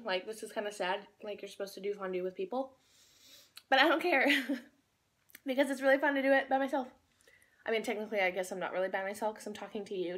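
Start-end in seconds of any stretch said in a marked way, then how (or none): 3.7-6.9 loud and cheerful. Real, not acted. Defiant cheerful outburst.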